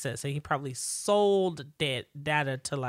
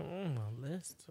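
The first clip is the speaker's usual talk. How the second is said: slow, much softer puzzled murmur; a lightly voiced, confused mumble; mock puzzled Julian; 3 semitones lower, 12 dB quieter, slower than usual. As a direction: Julian squints at the list, slow and soft. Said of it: "And he's like".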